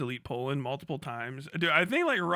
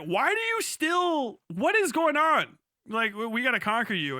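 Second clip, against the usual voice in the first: bewildered Catalan; 7 semitones higher, 3 dB louder than usual. Retold or imitated. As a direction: stretch out the confusion.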